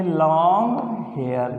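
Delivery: Long hair drawn out slowly for writing; slow dictation. Shown as a demonstration. Teacher slowly dictating long hair to the class. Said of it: "क्या लिख दिया हमने".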